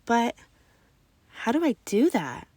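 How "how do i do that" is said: plain reading voice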